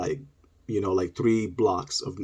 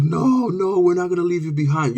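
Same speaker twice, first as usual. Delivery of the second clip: mock tearful pleading; 8 semitones higher, 3 dB louder than usual. Cousins to the boy left behind, mock tearful plea. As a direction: imitate teary cousins refusing to go. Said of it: "we were crying, like, teary-eyed".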